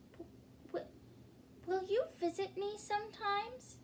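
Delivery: timid hopeful stuttered question; shy stammer. Acted. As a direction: stammer it out shyly. Dragon shyly asks.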